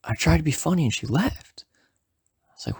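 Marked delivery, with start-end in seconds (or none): 0.0-1.7 retold high-pitched complaint; pissed off. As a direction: imitate an exasperated friend, voice pitched up. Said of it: "he's pissed".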